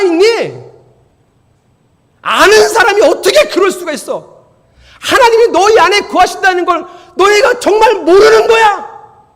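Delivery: high, full-force shouting in reproach; loud, incredulous preaching yell; impassioned rebuke. Acted. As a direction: cry it out as an outraged apostle scolding the church. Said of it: "이렇게 얘기한 거예요".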